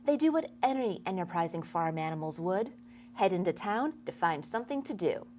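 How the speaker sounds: ordinary talk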